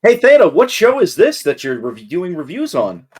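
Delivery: feed them the question with exaggerated curiosity; mock prompting. Feeding co-host a plug question.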